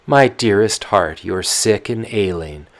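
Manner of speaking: put-on loud, fussing old nurse voice; the old nyanya, voiced up loud and fretful. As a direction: nurse grumbling about her poor memory. Nurse answers Tatiana.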